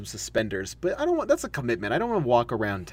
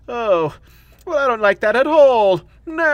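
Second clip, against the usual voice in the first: put-on higher and louder, a pouting child's complaint; petulant childlike Doctor; 10 semitones higher, 8 dB louder than usual. The second Doctor refusing something, a loud pouting whine. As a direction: refuse it like a sulky little boy. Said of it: "he's very childlike and kind of just like".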